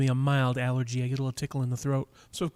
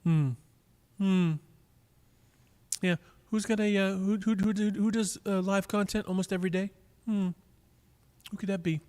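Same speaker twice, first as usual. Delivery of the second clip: mock wondering; 5 semitones higher than usual. Mock wondering, teasing a viewer.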